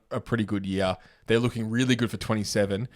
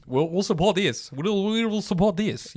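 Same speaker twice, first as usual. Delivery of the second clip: put-on Spanish accent, higher and slower; 9 semitones higher than usual. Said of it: "That's my Spanish accent".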